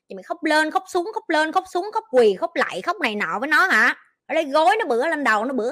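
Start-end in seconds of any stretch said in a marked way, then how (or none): 0.1-3.9 much higher, teasing sing-song mockery; mocking. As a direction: ridicule all the ways they will cry. Mocking the viewer's crying.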